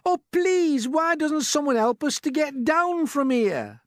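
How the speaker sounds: very high, loud, drawn-out plea; pleading call. A narrator's reading. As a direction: beg for help from far above. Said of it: "Miss Spider called out".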